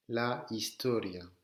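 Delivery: HISTORIA starting straight on the vowel, slowly; slow model of historia with no H sound; silent H. Shown as a demonstration. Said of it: "le H ne se prononce pas". (H muet, prononcé lentement)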